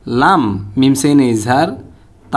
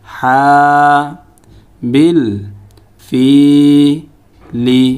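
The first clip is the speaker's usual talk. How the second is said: each syllable alone, with a pause after; measured Quran drill, one syllable at a time; broken syllable-by-syllable reading. Shown as a demonstration. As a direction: break the verse into separate syllables. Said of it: "এখন আমরা ভাঙা ভাঙা উচ্চারণ করব".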